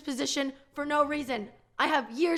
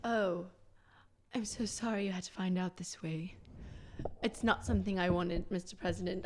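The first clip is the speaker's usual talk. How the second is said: whispered soap-opera apology; melodramatic whisper; 7 semitones lower, 7 dB quieter than usual. Acted. Aide confessing to the president, in a melodramatic whisper. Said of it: "focus on the melodrama".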